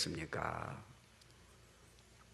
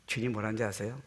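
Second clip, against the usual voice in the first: usual speaking voice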